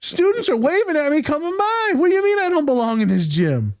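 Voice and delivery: high, incredulous mock outrage; retelling a clash with a guard, high indignant; indignant outburst. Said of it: "I'm like".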